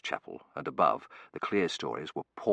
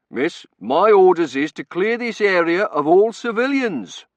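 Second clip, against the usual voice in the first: loud, slow, raised official order; officious warden; 11 semitones higher, 12 dB louder than usual. A narrator's reading. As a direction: bellow orders at a civilian. Warden overrules screaming woman.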